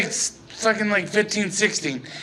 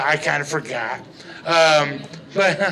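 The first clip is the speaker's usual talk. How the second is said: ordinary talk, nothing marked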